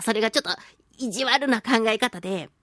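ordinary talk

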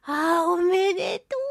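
ordinary talk, nothing marked

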